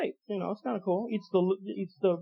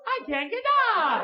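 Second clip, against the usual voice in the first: put-on obnoxious old lady; 11 semitones higher than usual. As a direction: stretch it out, helpless and pitiful. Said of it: "the most obnoxious voice in the world".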